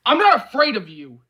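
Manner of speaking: loud, tight defiance pushed through gritted teeth; squaring up to his drunk friend, through gritted teeth. Acted. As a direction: bite each word back at him. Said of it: "he says through his teeth".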